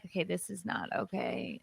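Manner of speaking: hushed, muttered to herself; a quiet retold aside; quiet realization. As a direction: say it softly, giving up inside. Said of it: "So I'm like".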